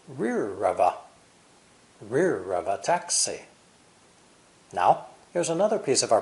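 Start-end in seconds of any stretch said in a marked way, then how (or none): 0.1-0.9 "rear of a" run together as one word; linked words. Shown as a demonstration. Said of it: "are linked together". 2.0-3.4 slow "rear of a" linked into stressed "taxi"; linked words. Shown as a demonstration. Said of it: "are linked together".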